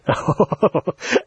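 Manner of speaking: airy chuckle at one's own joke; hammed-up chuckle; stagey laugh.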